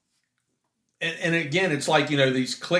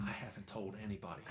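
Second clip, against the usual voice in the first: much softer, secretive little voice; hushed kid; 6 semitones lower, 24 dB quieter, faster than usual. Retold or imitated. Boss's son sneaking over to report he kept the secret, hushed. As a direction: keep it hushed like a proud child keeping a secret.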